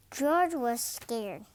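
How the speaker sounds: ordinary delivery, nothing marked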